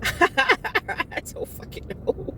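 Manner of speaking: breathless laugh through the words; real laughter still in the voice. Real, not acted. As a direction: giggle it out slow and airy. Laughing after long laugh.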